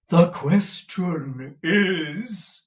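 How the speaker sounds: put-on florid, theatrical old suitor, high and slow; old gentleman. A narrator's reading. Dotty old gentleman courting Mrs Nickleby over the wall, grand and slow. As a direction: woo her grandly like a dotty old gallant.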